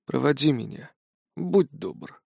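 put-on soft, frail old man's request; quiet old voice. A narrator's reading. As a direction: ask gently like a dying old man. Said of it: "тихо попросив напоследок".

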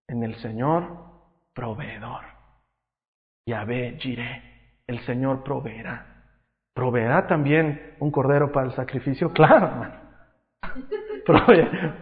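ordinary talk, nothing marked

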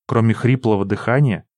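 usual speaking voice

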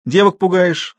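reproving challenge; stern questioning. A narrator's reading. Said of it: "строго спросил он".

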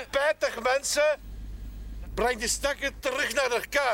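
high call to the crew. Acted. Policeman calling the workers to return the body. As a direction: tell them to take her back.

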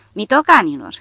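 bellowed insult. A narrator's reading. Soldier insulting those firing at him, bellowing. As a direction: bawl the insult out in one burst. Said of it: "zbiară el".

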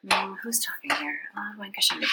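soft unvoiced whisper to herself; whispering aside. Real, not acted. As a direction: whisper the aside under your breath. Aside to herself while reading.

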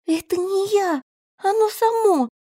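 high protest. A narrator's reading. Girl protests innocence.

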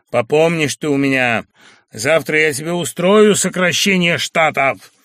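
loud, angry threatening; spiteful threat. Acted. Sharikov threatening the girl, loud and spiteful. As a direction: hiss a grudge at her. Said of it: "вдруг злобно сказал он".

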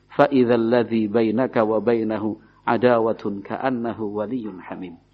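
measured melodic Quran chant; Quran recitation. Acted. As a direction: recite it like tilawah, drawing out the vowels.

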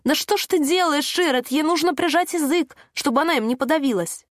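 high-pitched, held-back shout; shouting in alarm. A narrator's reading. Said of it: "и кричала".

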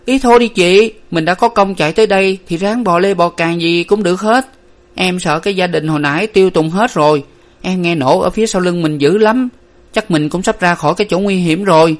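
high-pitched frightened pleading; shuddering exclamation. A narrator's reading. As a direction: cry out in dread, then plead to keep going. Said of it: "Hà rùng mình kêu lên".

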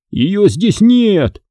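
high, stretched-out cry of dismay; narrated shout. A narrator's reading. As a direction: cry it out slowly, pitched high. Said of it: "закричал он". (протяжный высокий вскрик)

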